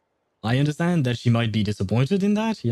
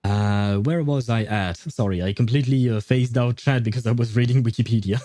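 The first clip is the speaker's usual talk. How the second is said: ordinary talk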